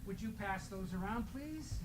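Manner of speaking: usual speaking voice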